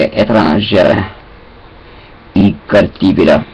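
usual speaking voice

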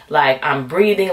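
ordinary talk, nothing marked

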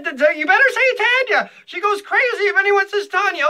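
imitating Jerry. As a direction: play the crazed husband stammering a warning. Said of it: "He said".

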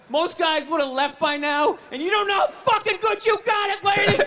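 father yelling his catchphrase at mom; dad's catchphrase yell. Retold or imitated. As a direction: yell it like the old man at mom. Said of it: "one of his catchphrases".

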